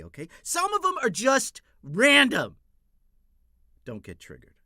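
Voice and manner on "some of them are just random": sudden yell. Acted. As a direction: jump to a loud, high shout on the last line. Escalating trigger words bit.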